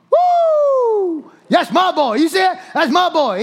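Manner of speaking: loud, high whoop of parental pride; cheering parent in the stands; shouting. Acted. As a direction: holler proudly for your kid's home run. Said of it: "Tell me you don't know how to shout".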